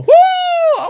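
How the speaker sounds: very high, very loud cheer; excited whoop. Real, not acted. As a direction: whoop at the skill. Cheering a piece of skill.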